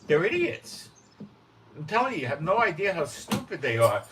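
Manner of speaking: loud, high-pitched exasperation; scornful outburst. Real, not acted. Preacher telling listeners how stupid demon followers are, scornful outburst. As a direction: raise your voice in real scorn.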